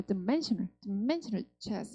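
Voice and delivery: "dimensional" as d'MENsional, first syllable light; unstressed D. Shown as a demonstration. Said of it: "드 하시는 거야". (dimensional의 D를 드로 약하게)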